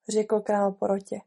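ordinary delivery

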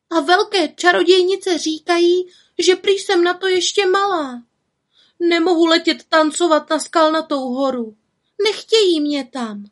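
put-on high, plaintive young voice; aggrieved little witch. A narrator's reading. Little witch left out of dance, plaintive complaint.

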